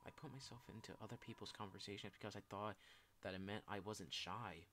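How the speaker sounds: subdued, self-blaming apology; dejected confession. Acted. Shy person apologizing for past awkward moments, dejected confession. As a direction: confess old regrets in a low-key, downcast voice.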